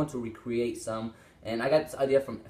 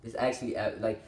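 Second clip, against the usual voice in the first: usual speaking voice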